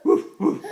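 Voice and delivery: loud, breathy woofs; two short loud barks; dog bark. Pretend. Parent playing dog for a child, breathy barking.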